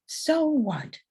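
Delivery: put-on pissed-off kid. A narrator's reading. Kid talking back to the ma, mildly pissed-off retort. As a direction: spit it out like a cross kid, without shouting. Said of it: "pissed off kid spits out".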